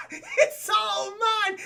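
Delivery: high, loud maniacal cackling; Joker laugh. Acted. Hobgoblin claims Kingpin's base, manic Joker laugh. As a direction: gloat slowly through a rising villain cackle. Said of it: "does a goddamn Joker laugh".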